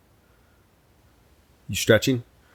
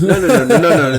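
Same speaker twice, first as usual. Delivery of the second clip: loud protest; 7 semitones higher, 8 dB louder, faster than usual. Real, not acted.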